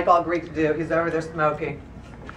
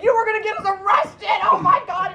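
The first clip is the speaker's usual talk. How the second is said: high-pitched hammed-up freak-out; mock panicked shout; 13 semitones higher than usual. [name] panicking about getting arrested, high mock shout. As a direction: cry out in exaggerated alarm.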